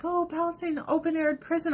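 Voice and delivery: high-pitched mocking whine; high mock concern; mock lament. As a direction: parody a hand-wringing protester. Mocking people's complaint.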